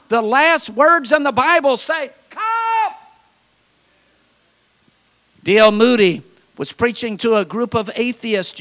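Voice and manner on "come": high, held, softened call; distant high summons. Acted. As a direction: call it from afar on a high note. Calls out Bible's last word.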